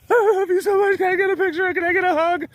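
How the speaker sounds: gushing fangirl. Retold or imitated. Gushing fan asks for hug.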